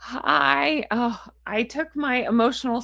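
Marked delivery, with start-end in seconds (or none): none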